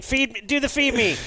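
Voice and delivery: high-pitched mock yell at the screen; excited yell, pitched high but not full volume; imitated yelling. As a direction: re-enact yelling at the game, pitch way up. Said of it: "I'm yelling at the screen".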